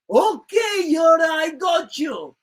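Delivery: eager to obey, call it back; mock Russian shout. Sailor shouts back to Yuri, eager mock Russian shout.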